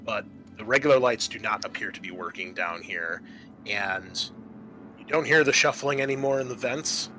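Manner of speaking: ordinary delivery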